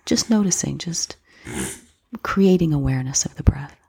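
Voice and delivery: ordinary talk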